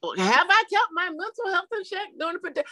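high, put-on questioning voice; mock incredulous echo. Guest repeating the host's question, playfully incredulous.

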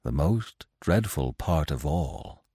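put-on low, soft, slow old stag. A narrator's reading. Old stag answering Bambi in the hollow, low and grave. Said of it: "the old stag answered".

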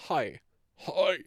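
put-on faint, dying old-man "Hi"; mock dying whisper of a greeting; Biden last breath. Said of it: "him on his last breath".

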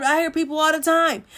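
ordinary delivery

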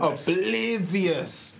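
slow, drawn-out nature documentary narrator; David Attenborough. Retold or imitated. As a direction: stretch it like a wildlife documentary. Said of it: "wildlife at Attenborough".

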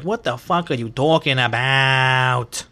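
loud, drawn-out mock outburst; imitating annoyed mom. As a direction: imitate an exasperated mom, stretching the words out loudly. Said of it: "when she gets annoyed".

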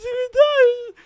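high, cracking mock sob; mock crying. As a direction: wail it like you're about to cry. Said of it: "tearing up".

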